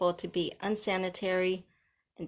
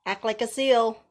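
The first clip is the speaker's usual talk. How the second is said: louder, ordering a trained animal; loud command; 3 semitones higher, 6 dB louder than usual. Pretend. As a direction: call the trick out loud. Commanding haters like dogs.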